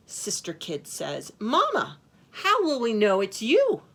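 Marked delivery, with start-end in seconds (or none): 1.4-3.8 put-on high, loud child's question; little kid goat. A narrator's reading. Sister Kid asks Mama at the door, high childlike voice. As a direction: pipe up like a young kid.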